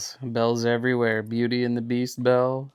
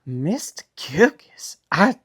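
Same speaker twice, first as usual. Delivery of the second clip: whispered, slow Southern belle drawl; a delicate Southern belle, breathy and coy; Southern belle greeting her gentleman caller; Southern belle accent; 3 semitones higher, slower than usual. Pretend. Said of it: "I love your take on that accent".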